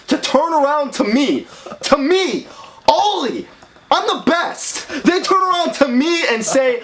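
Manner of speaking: high-pitched, affronted boast; offended, self-important protest; indignant disbelief. Acted. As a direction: pitch jumps up in outrage at the insult. Indignant rant about others' gall.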